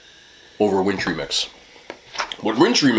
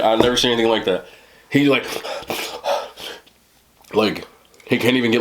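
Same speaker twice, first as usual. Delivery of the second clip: usual speaking voice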